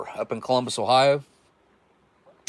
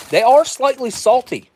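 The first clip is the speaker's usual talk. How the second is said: surprised; 13 semitones higher, 10 dB louder than usual. Real, not acted. Tasting snack, sudden exclamation.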